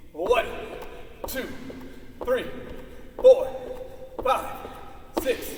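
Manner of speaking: loud, energetic coach count, punching each number. Real, not acted. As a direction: call each number out over the exercise. Coach counts jumping jacks aloud.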